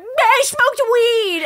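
high, loud, very slow confession; hammed-up fearful admission; scared confession. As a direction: confess in a tiny panic.

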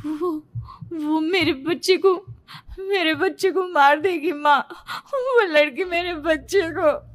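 high, slow, faltering plea; distraught and halting; frightened, tearful. Acted. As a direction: tell your mother in dazed terror, near tears. Dazed daughter, afraid for her child.

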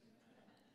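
a quiet self-conscious chuckle; soft breathy laugh. Real, not acted. To the audience after her story, a soft laugh. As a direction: let out a small embarrassed laugh.

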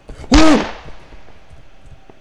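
loud, high whooping gasp of fright; sudden sharp jump-scare gasp; real frightened intake and cry; startled gasp. Real, not acted. Jump scare while lost.

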